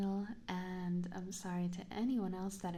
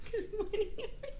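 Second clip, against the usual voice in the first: laughing; 14 semitones higher, faster than usual. Real, not acted. Greeting chat mid laughing fit.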